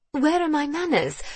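high-pitched, flustered hostess; flustered lady. A narrator's reading. Julia remembering she offered no seat, high and flustered.

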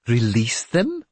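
startled question, rising at the end; incredulous exclamation. A narrator's reading. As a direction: repeat it back in disbelief. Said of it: "Sigtrygr exclaimed".

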